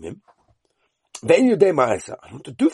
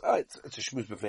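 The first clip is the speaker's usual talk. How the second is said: ordinary talk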